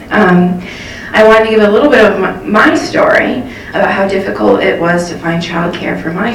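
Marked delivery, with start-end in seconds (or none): none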